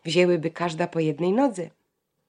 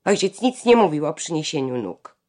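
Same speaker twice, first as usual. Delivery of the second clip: louder, dug-in refusal; stubborn refusal; 7 dB louder than usual. A narrator's reading. Janka refusing her sister stubbornly, louder. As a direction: refuse flatly, word by word. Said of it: "Janka zawzięła się".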